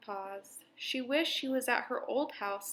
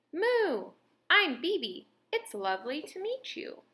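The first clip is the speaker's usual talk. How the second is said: cheerful kitten Bebe; 5 semitones higher than usual. A narrator's reading. Bebe the cat speaks.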